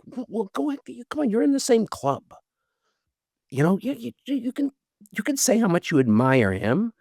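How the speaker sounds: high, jittery urging to himself; nervous self pep talk. Retold or imitated. Working himself up to talk to a celebrity he admires, high and nervous. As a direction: psych yourself up to greet a hero, stammering. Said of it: "I work myself up, and I'm like".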